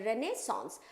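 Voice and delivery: normal talking voice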